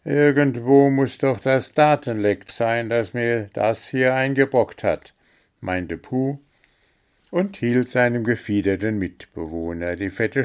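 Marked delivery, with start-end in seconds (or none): 0.0-5.1 louder, higher gnome grumbling; a cross gnome hunting a leak; grumpy gnome. Acted. Puh's voice in story.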